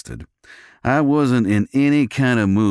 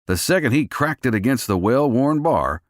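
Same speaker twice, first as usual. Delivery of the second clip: ordinary delivery, nothing marked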